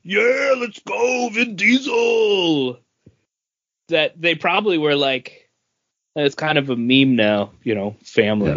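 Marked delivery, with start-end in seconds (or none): 0.0-2.8 hyped fan cheer. Retold or imitated. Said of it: "likely to be like".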